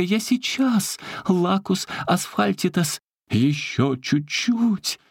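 high, slowing on the spell; urgent shout. Acted. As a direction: cry out while rushing. Улитка спешит на помощь. (высокий призыв-заклинание)

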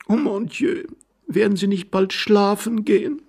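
very high, pleading woman's voice; impatient young wife. Acted. Wife wants husband off to bed, impatient. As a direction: urge him away in a high voice.

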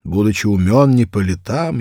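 ordinary delivery, nothing marked

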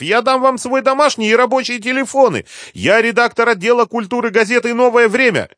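very high, loud, frantic plea; desperate screaming. A narrator's reading. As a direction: shout pleading not to be cut off. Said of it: "закричала Света".

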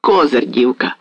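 plain reading voice

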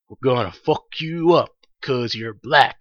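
a hint of a bullying cop; low, lightly put-on cop voice; mock cop threat. Cops threatening in retold scene.

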